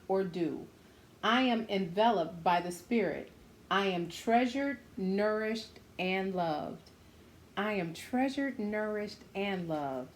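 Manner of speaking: plain reading voice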